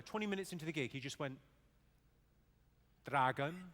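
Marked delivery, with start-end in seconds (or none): 3.1-3.7 loud, lilting Welsh "Dragon"; Welsh accent. Retold or imitated. Man in front row at Cardiff gig, loud Welsh accent. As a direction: blurt it out like a Welsh heckler. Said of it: "doing a gig last year in Cardiff".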